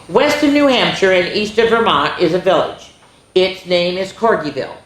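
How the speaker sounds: put-on lively read-aloud for young kids; sing-song picture book voice; storybook reading. Begins reading new book.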